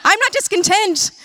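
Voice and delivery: high-pitched mock protest; hammed-up indignant denial. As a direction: deny it in a jump of pitch, playfully indignant. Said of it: "I was discontent".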